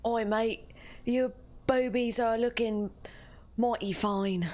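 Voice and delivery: lightly put-on Australian accent, slow and cheeky; slowed, teasing Australian flirting line; mock Australian accent. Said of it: "Australian, like, dirty talk".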